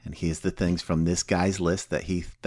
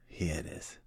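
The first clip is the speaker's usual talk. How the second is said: breathed out in a hushed whisper; 3 semitones lower, 14 dB quieter than usual. Real, not acted. Revealing Bill's list to the group in a hushed whisper.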